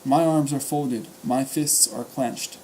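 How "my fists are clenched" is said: plain reading voice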